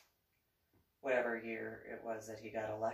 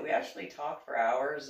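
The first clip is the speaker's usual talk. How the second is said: ordinary delivery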